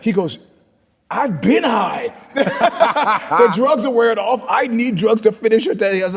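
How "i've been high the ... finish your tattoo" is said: high, loud drawn-out mock shout; exasperated outburst. Tattooist telling client he needs drugs to finish, exasperated shout. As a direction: imitate an indignant yell, stretching the words.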